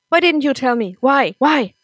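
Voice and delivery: loud, high, accusing; angry shout. Acted. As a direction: hurl the repeated why at her. Nick confronts mother, shouting.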